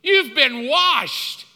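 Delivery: high, loud, slow declaration; God proclaiming. Acted. God declaring your past washed, proclaiming loudly. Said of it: "this is what he says about your past".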